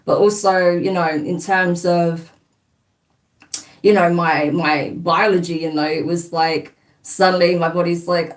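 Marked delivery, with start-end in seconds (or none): none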